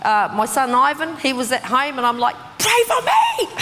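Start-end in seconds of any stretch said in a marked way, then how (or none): 2.6-3.5 high-pitched hammed-up cry for help; shrill-pitched mock desperate plea; retold yell. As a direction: re-enact a panicked yell. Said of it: "I yelled out to my son".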